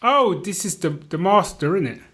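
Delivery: normal talking voice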